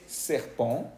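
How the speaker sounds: "serpent" with French R, nasal ending, silent T; French pronunciation. Shown as a demonstration. Teacher translating serpiente, French nasal ending. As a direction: say "serpent" as sair-PON, final N unsounded. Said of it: "tenemos serpiente".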